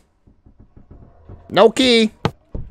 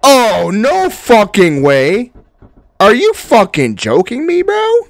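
loud, high, slow disbelief; amazed outburst; 10 semitones higher, 10 dB louder, slower than usual. Real, not acted. Gamer can't believe his luck, amazed. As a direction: shout in happy disbelief.

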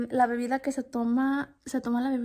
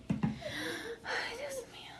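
high, breathless whispered gasp; whispered dismay; 12 semitones higher, 11 dB quieter, much slower than usual. Real, not acted. Mother sees her child has no shoes or shorts. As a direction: gasp it under your breath. Finds kid without clothes.

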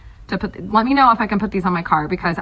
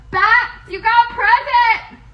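calling to bats; 13 semitones higher, slower than usual. Real, not acted. Calls out to her bats.

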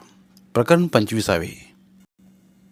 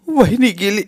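sobbing news; 10 semitones higher, 5 dB louder than usual. Acted. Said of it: "सुलून हुंदका दिला".

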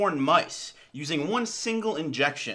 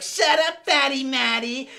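mocking bully; 6 semitones higher, 4 dB louder than usual. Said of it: "And they're all like".

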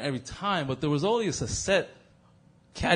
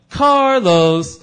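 loud, drawn-out, higher name call; mock scolding call; 4 semitones higher, 12 dB louder than usual. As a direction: shout it like groaning classmates. Classmates' scolding catchphrase call.

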